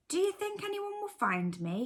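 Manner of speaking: high little-bear voice; a small bear calling out from hiding; squeaky little cub asking from the heap. A narrator's reading.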